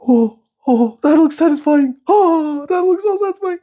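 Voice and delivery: very high, thrilled cooing; awed, high-pitched delight; delighted squealing. Real, not acted. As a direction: squeal with satisfaction. Watching screen protector stick down.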